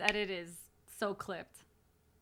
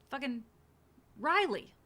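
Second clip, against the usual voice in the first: exasperated; 4 semitones higher, 5 dB louder than usual. Real, not acted. Exasperated at the nomination.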